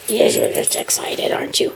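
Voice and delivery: soft whisper to the dog. Real, not acted. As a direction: whisper it gently.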